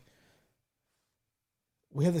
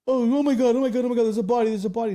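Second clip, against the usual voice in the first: mock freak-out, voice jumping up and louder; 8 semitones higher, 8 dB louder than usual. Man filming discovers a body in the store, imitated as freaking out. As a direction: imitate a loud, high-pitched panic. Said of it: "starts freaking out".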